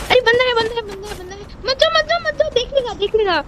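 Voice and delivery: high-pitched, urgent repeated warning; urgent alarm. Real, not acted. Player warning a teammate away from a spotted enemy, urgent alarm.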